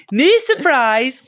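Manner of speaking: slow, loud, high Geordie put-on; hammed-up Newcastle accent; Northeast accent. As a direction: stretch it out in mock Geordie. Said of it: "sorry, to the Northeast".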